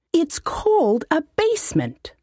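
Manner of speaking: high, put-on voice of a fussy old halfling mage; high-voiced wizard. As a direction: whine it like a pompous little wizard. Wizard corrects demon.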